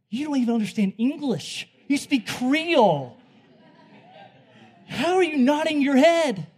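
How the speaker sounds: high, loud, comic disbelief; incredulous yelling. Retold or imitated. Preacher acting out his reaction to a nodding boy, incredulous yelling. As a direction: yell it in mock bewilderment, stretching the last words.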